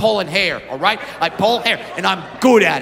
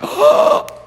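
high, loud gasp of delight; sharp excited gasp; 5 dB louder than usual. Retold or imitated. Fan gasping as Brad runs on stage, loud and high. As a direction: gasp like seeing a star. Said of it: "and went".

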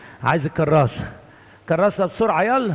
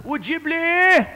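Sa'idi priest voice; 10 semitones higher than usual. Retold or imitated. Old priest asking children for notebooks, Upper Egyptian accent. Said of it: "كان يتكلم عربي بالعافية يعني".

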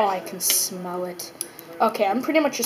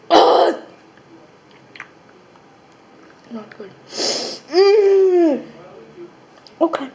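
after eating wasabi; 7 semitones higher, 8 dB louder, much slower than usual. Real, not acted. Wasabi still burning.

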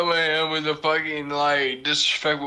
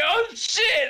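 high startled curse. Retold or imitated. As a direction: squeak it out in alarm. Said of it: "he's like".

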